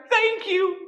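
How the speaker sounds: high, loud burst of gratitude; overjoyed thanks. Acted. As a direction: gush it out with delight. Angel promised his wings.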